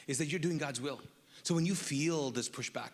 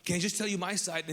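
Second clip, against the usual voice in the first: pleading self-defence; 7 semitones higher than usual. Retold or imitated. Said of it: "and I'm like".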